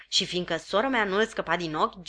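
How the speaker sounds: ordinary delivery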